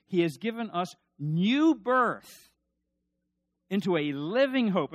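preaching at full force. Acted. Preacher driving home the Trinity to his congregation, loud and fervent. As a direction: proclaim it to the back pew, swelling.

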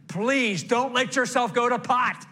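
high, loud pulpit plea; exhorting plea. Real, not acted. Preacher pleading with men and women, loud exhortation. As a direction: urge the congregation at full voice.

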